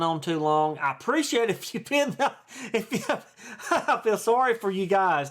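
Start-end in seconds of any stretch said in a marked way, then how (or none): 1.5-5.3 chuckling through the words, higher pitch; self-amused chuckle; laughing. Real, not acted. Chuckling at his own rambling.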